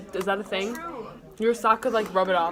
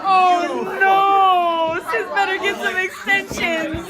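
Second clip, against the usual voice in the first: loud laughing shout; 6 semitones higher, 6 dB louder, much slower than usual. Real, not acted. Friends teasing, laughing loud.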